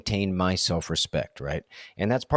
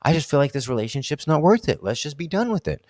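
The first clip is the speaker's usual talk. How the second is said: frustrated outburst; 5 semitones higher than usual. Retold or imitated. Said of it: "I just get so frustrated".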